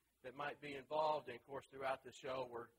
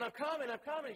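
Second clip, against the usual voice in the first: calling out; 12 semitones higher, 7 dB louder than usual. Retold or imitated. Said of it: "he's going".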